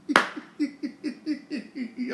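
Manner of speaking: high, soft burst of laughter; real laugh. Real, not acted. Laughs at Zimmer firing.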